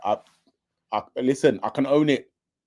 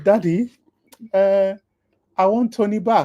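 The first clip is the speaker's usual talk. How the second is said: put-on higher, pleading childish whine; whiny son; 7 semitones higher, 4 dB louder than usual. Friend mocking Harrison begging his dad, childish whine. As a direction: beg like a spoiled boy. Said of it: "He said".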